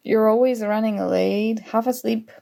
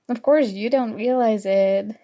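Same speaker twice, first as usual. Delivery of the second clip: normal talking voice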